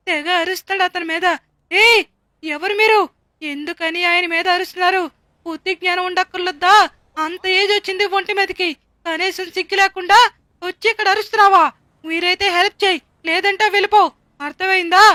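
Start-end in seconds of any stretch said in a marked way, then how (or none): 1.7-5.0 angry scolding. Acted. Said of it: "రెండు తిట్టగానే". 5.4-6.7 బుద్ధి జ్ఞానం ఉండక్కర్లేదా thrown back louder at him; angry scolding. Acted. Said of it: "రెండు తిట్టగానే". 7.2-15.0 angry scolding. Acted. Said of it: "రెండు తిట్టగానే".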